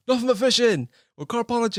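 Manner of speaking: high, eager call of a fan spotting him in the street; excited fan shout. Retold or imitated. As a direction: pitch it way up like a fan calling out. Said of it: "would be like".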